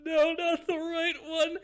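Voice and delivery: high, slow, loud falsetto whine; whining plea. Acted. Prisoner refusing to hold out his arm, high wailing plea. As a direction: wail it out, drawing out the words. Character begs against losing right arm.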